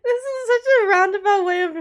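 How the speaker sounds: high, teary voice with a sob; words pushed through tears; crying through the sentence; crying while talking. Real, not acted. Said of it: "Okay, I stopped crying".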